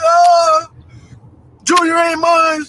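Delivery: high, loud mock wail; hammed-up crying yell, drawn out. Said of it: "I'm yelling, screaming, and crying".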